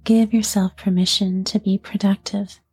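unhurried yoga nidra voice, letting each phrase settle; guided sleep meditation. Acted. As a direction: ease a listener toward sleep, phrase by phrase. Leads guided sleep relaxation.

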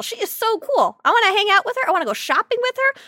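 high, gushing fangirl excitement. Real, not acted. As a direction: squeal it like a starstruck fan. Gushing about a favourite character.

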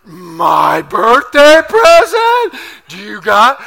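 put-on slow, loud, drawn-out child voice; eager kid. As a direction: stretch it out like an excited boy. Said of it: "And he goes".

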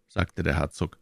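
ordinary delivery, nothing marked

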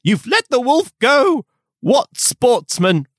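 high, slow, indignant scolding; hammed-up angry huntsman; threatening shout. A narrator's reading. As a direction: berate the Count in outraged disbelief. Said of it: "holding up his whip threateningly".

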